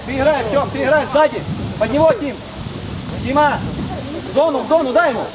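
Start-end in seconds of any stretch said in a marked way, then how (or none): none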